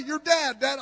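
confused son. Retold or imitated. Confused son answers again.

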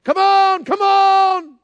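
high, full-voiced holler; loud, drawn-out calling shout; yelling for dogs. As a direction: imitate a hunter calling his dogs back across the valley. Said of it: "my papa started yelling for his dogs".

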